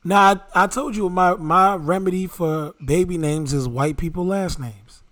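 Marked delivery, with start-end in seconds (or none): none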